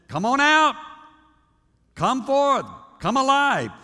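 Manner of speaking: loud, high, offhand come on out; casual loud call. Shown as a demonstration. Pastor mocks a careless call, loud and high. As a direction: shout it like calling a kid outside. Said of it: "No, no, no".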